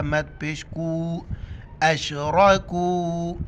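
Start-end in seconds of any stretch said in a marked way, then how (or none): 1.8-3.4 अशरकू chanted slowly with the final oo stretched; elongated madd. Shown as a demonstration. Teacher showing four-alif stretch, elongated madd on अशरकू. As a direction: hold the कू of अशरकू for four counts. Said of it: "मद है, चार अलिफ की मिकदार खींचना है".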